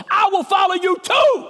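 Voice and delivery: high, rousing shout; screamed declaration. Retold or imitated. Man standing up in the theater, high excited shout. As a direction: re-enact an over-excited movie-goer's yell. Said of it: "I screamed out to the top of my lungs".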